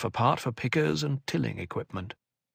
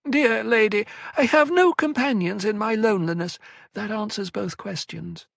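whining elfin voice; 10 semitones higher, 3 dB louder than usual. A narrator's reading. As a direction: whine sweetly like a small schemer. Tleilaxu Cytail's voiced lines.

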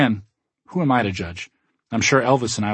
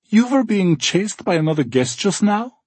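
put-on cheerful German accent; 9 semitones higher, 4 dB louder than usual. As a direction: welcome guests warmly, German accent. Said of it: "Gunther inquires".

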